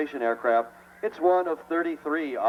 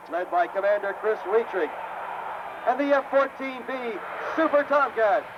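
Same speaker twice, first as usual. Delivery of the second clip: hyped announcer intro; 6 semitones higher than usual. Real, not acted. Announcer introducing crew and jet.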